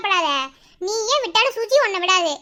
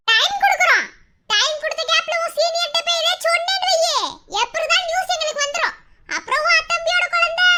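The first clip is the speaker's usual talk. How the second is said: raised, higher-pitched warning; menacing threat; 5 semitones higher than usual. Acted. Threatening her over the child.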